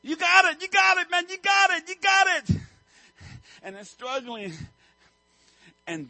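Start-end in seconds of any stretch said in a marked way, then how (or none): 0.0-2.6 hammed-up coach's shout, much higher and louder; cheering. Viewer cheering the chick out of its egg, excited shout. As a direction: scream encouragement like a sideline fan.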